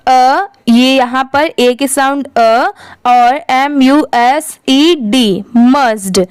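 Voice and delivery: ordinary talk, nothing marked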